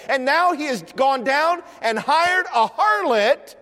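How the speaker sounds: high, insistent list of charges; forceful preaching. Real, not acted. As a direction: drive each charge home from the pulpit.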